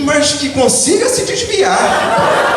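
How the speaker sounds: high, slow take-back through a laugh; embarrassed correction. Retold or imitated. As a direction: quickly soften the curse, laughing at yourself. Hasty correction of her own curse. (correção envergonhada, aguda e lenta, rindo)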